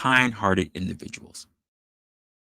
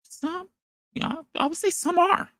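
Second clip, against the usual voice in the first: mock high voice. Mock high voice answering himself.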